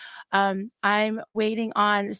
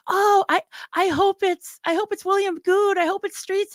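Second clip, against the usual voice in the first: high, hopeful mock chat voice, a bit louder; eager fan voice; 11 semitones higher, 3 dB louder than usual. Chat viewers hoping for a favourite guest, mock eager. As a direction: imitate fans hoping for a guest. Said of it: "people were like".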